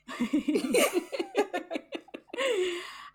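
long high-pitched giggle. Real, not acted. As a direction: giggle high at your own joke. After joke about punctuation.

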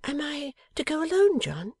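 high-pitched wifely question; Bella, plaintive wife. A narrator's reading. Wife asks husband if she goes alone, plaintive. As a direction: ask it high, a little hurt. Narrator voices the dismayed wife.

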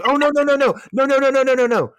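high, loud string of frantic no's; panicked no. Acted. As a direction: shout no to stop her, panicking. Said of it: "He goes".

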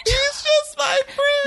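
high, slow mock squeak through laughter; laughing mock. Friend repeating the girl's excuse, high mock voice through laughter. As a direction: parrot her line while cracking up.

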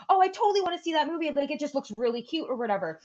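high, fast gushing mom voice; imitating excited mom. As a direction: play the eager mom wanting to go. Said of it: "She goes".

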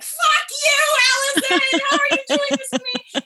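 high, loud, fast laughing yell; laughing mock scream. Actress retelling crying so hard she screamed, laughing shriek. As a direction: shriek it at your co-star, half laughing. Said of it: "I just started screaming".

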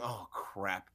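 very soft, low private groan of words; resigned mutter under the breath; muttered dread. Retold or imitated. Reporter sitting courtside waiting to interview, muttered dread.

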